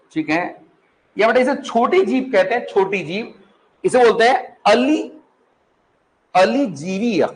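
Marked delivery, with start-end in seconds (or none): none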